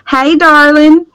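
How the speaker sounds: high, slow Southern drawl on darling; sugary Southern accent, slow and high; Southern belle. Pretend. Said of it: "it's gonna be like".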